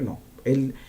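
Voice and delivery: ordinary delivery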